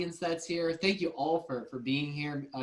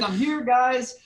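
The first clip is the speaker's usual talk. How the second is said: higher, louder mock announcement; playful calling out; 7 semitones higher, 7 dB louder than usual. Joking to the group about arriving in the woods, playful calling out. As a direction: call out to the group through laughter.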